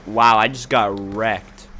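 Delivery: drawn-out loud groan of defeat; loud dismay. Real, not acted. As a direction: moan it out loud after getting killed. Gets killed in game.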